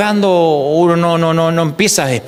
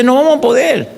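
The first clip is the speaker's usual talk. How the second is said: raised plea; 8 semitones higher than usual. Retold or imitated. Josafat admitting to God they cannot win, raised plea. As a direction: cry out that we cannot do it.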